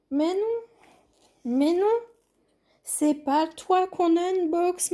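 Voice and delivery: high, playful voice for a pet; talking to pet. Real, not acted. Talking to her pets Fifi and Kaline. (voix aiguë et câline pour parler à son chat)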